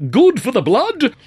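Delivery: high, loud, slow grand-dame voice; Lady Marjorie voice. A narrator's reading. Actor does his Lady Marjorie for the laughing crowd. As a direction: play the pompous old lady. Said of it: "Lady Marjorie opined".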